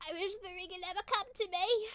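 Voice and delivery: high-pitched British accent impression. Streamer quoting a film line for chat, British accent. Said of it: "Is that a good British accent?".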